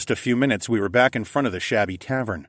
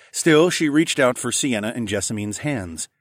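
ordinary delivery

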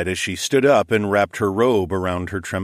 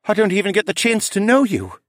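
high, louder, distressed voice; upset, near sobbing; 13 semitones higher, 5 dB louder than usual. Acted. Son losing the mother he just met, high and upset. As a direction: protest through held-back tears. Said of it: "choked back another sob".

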